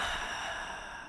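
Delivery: long breathy sigh after laughing. Real, not acted. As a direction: let the air out after a giggle.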